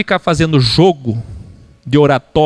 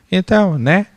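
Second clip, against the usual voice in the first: normal talking voice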